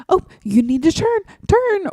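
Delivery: urgent mock warning climbing high; backseat-driver panic, rising sharply on the last word; panicky parent. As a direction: imitate an alarmed passenger giving orders. Alarmed parent directing new driver.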